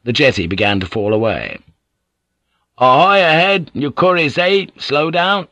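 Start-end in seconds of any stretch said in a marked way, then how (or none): 2.8-5.5 high, loud hail across the water; hailing call. A narrator's reading. Captain hailing the galley across the water, high loud call.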